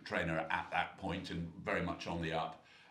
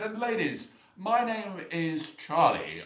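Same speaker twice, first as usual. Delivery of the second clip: much higher, slow and pompous; grand self-introduction; 11 semitones higher, 7 dB louder, slower than usual. Retold or imitated. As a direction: lean back and announce yourself grandly. Trainer grandly introduces himself.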